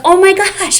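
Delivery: put-on high, delighted gasp of a little girl; excited child. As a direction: squeal it like a thrilled kid. Child thrilled about babysitter's house.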